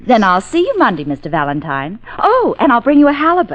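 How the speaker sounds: loud mocking taunt. Secretary taunting her arrested boss, mocking. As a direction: rub it in with mock sweetness.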